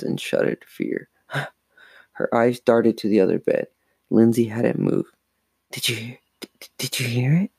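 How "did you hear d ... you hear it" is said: hushed, halting stammer; frightened stammer. A narrator's reading. Frightened girl to her sleeping sister at night, stammering. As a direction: trip over the first words, softly. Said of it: "Chris stammered".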